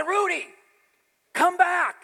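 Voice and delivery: high, loud plea to come back; desperate call. Retold or imitated. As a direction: shout for the dog to return. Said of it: "'Cause I was de-, desperate".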